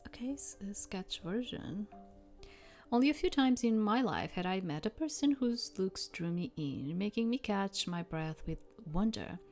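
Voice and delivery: plain reading voice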